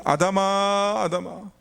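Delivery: searching call, vowels held long; God calling out. Acted. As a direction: call out slowly for someone hidden. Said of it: "창세기 말씀 보면요".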